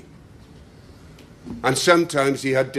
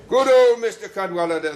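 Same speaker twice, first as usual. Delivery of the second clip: louder and higher, a happy group cheer; cheering cry; 8 semitones higher, 7 dB louder than usual. A narrator's reading. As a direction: cry out his name in delight. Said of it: "they cried".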